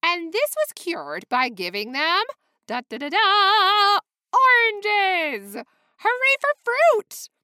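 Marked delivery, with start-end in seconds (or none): 2.7-5.6 excited drumroll. Acted. Drumroll reveal for a class. 6.0-7.3 cheering. Real, not acted. Cheering the answer.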